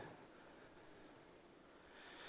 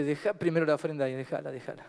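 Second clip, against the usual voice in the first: soft command; 8 semitones lower, 7 dB quieter, faster than usual. Acted. Jesus voiced, soft command.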